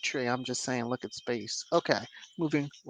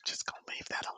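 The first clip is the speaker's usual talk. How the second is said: whisper; 15 dB quieter, faster than usual. Real, not acted. Sly aside about pregnancy rumor.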